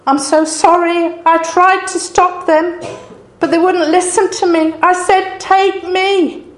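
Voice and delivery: high, loud, anguished pleading to her dead sons; a mother crying out over her sons' bodies. Retold or imitated. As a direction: beg God through the grief. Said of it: "I imagine her crying out to them".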